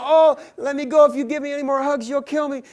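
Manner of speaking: high, loud panicked pleading; desperate begging. Acted. Songbird begging the giant woman to stop, high panicked plea. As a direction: beg to be let go before you're crushed. Bird begs for life.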